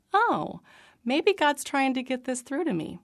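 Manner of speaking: a sudden lift as the penny drops; dawning realization. Retold or imitated. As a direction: catch on, delighted. Sudden realization about repeated verse.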